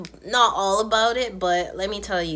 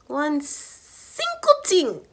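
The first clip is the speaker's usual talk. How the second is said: "one single thing" drawn out, high and slow; emphatic stress; 7 semitones higher, 4 dB louder, much slower than usual. Real, not acted. Telling viewers what a guy should have bought, emphatic stress on "one single thing".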